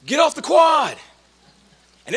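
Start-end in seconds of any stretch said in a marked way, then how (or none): 0.0-1.0 high, loud yell, name first, then the order; imitated yelling. Josh yelling at a younger classmate, mock yell. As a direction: holler the name, pause, then bellow the command. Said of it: "I remember him yelling at me".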